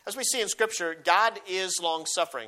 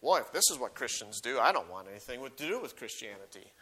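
lower, softer, a hint of a disgusted judge; unbelieving judge; 5 semitones lower, 4 dB quieter than usual. Preacher voicing an unbelieving judge dismissing Christians, lower and softer. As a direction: voice the unbeliever turning away, quietly. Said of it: "who's saying".

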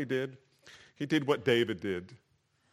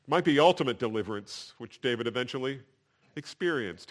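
ordinary talk, nothing marked

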